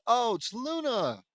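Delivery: high, loud, drawn-out exclamation; excited recognition. Real, not acted. Reader suddenly recognizes the character, excited. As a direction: cry out in delighted recognition.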